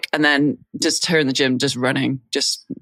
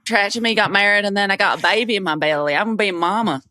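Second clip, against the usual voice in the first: put-on Southern American accent; 6 semitones higher than usual. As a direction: put on a broad Southern twang. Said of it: "Do, do your impression right now".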